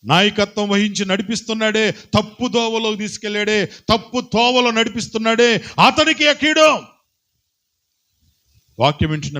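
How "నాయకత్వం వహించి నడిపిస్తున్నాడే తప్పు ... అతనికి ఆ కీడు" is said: rising higher and louder, slowing on the last words; preaching at full force. Acted. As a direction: crescendo of a fiery sermon. Building warning about the leader.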